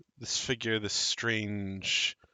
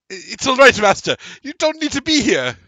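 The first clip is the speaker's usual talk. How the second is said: much higher, loud nervous reassurance; calm the master in a squeaky rush; high-pitched nervous servant voice; mage lady soothing; 12 dB louder, faster than usual. Acted. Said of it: "You see her go".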